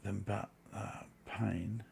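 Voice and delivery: breathy whispered groan of mild pain. Real, not acted. Pained sigh over chess pieces.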